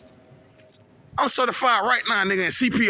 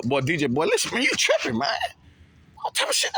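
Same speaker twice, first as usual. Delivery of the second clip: mock protest that jumps much higher; imitated friend's scolding; 5 semitones higher than usual. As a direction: play a friend calling him out, voice leaping high. Acts out candid friends scolding him.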